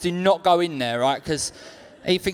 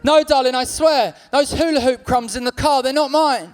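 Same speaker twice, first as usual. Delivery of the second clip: higher, louder pleading excuse; pleading partner; 6 semitones higher, 3 dB louder than usual. Pretend. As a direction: plead your innocence to her. Pleading with crisp girlfriend.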